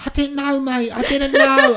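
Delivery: high, loud mock panic; hammed-up frightened protest; panicked pleading. Cornered rivals pleading innocence.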